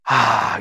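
low, soft relaxed sigh; deep breath. Shown as a demonstration. Pastor leading another deep breath, relaxed sighing out. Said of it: "take a deep breath again".

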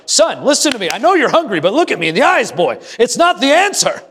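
high, insistent scolding of his son; mock angry dad. Father scolding his son over algebra, mock angry. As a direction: lay down the law to the boy. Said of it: "and I'm getting angry".

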